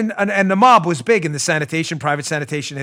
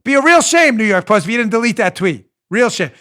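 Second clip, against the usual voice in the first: mobster voice; 5 semitones higher, 6 dB louder than usual. Retold or imitated. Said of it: "So Twitter's doing the".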